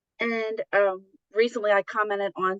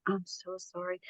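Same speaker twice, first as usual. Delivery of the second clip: soft apology; 12 dB quieter than usual. Retold or imitated. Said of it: "She's like".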